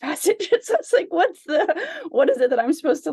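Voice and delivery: high, loud laughing through the words. Real, not acted. Sharing with the study group, laughing at her struggle with the passage.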